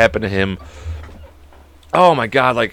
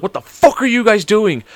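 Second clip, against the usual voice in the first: retold counselor yell; 10 semitones higher than usual. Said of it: "And she screamed".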